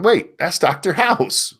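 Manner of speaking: slow, high wait-a-minute recognition; sudden double take; surprised realization. Retold or imitated. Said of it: "I'm like".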